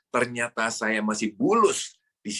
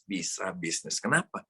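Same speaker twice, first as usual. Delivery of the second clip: normal talking voice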